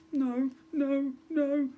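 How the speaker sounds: lightly voiced mumbling prince; a hint of dread under his breath. A narrator's reading. Goblin prince recognising the girl, mild mumble. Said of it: "started mumbling".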